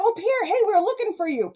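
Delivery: high-pitched, chirpy hail; mock eager greeting. Myron sees Pierre in Shorty's room, acted eager greeting. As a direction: call out as if delighted to spot someone.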